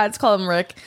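normal talking voice

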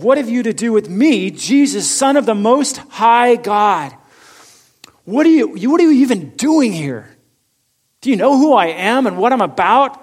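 loud, high, desperate demon cry; crying out loudly. A narrator's reading. Possessed man cries out at Jesus, loud and frantic. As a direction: hurl it at him like a cornered spirit. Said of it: "And crying out with a loud voice".

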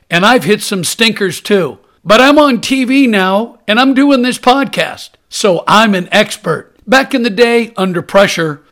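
pushy TV pitchman; infomercial. Acted. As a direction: sell it like a late-night ad.

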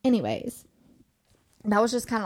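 ordinary talk

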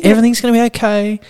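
high, quick soothing act; mock reassurance. Telling staff how to calm the customer, high mock reassurance. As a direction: reassure in a hurried high pitch.

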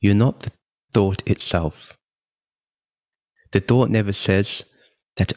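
ordinary delivery

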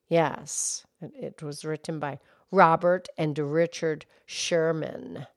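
put-on low, soft old lady voice; gentle granny puppet character; Mildred voice. Mildred explains the song.

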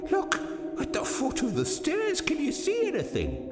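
high, urgent, fearful hush; frightened whisper. A narrator's reading. Hurst pointing Malcolm to the stairs, frightened whisper. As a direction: urge them to look, high and afraid. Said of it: "he whispered".

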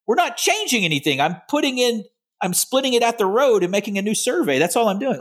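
high, pleading exasperation; exasperated protest. Retold or imitated. Exasperated retold reply to officials.

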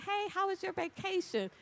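high cheerful ask; bright question. Retold or imitated. Asking the coworker about his vacation, high and bright. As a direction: sing it up high and friendly.

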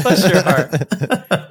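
slow, laughing Southern drawl on "Bless your heart". Pretend. Host jokes about the guest's Southern accent, slow laughing drawl. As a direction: stretch it out like a sweet Kentucky belle, laughing. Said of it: "I was gonna say".

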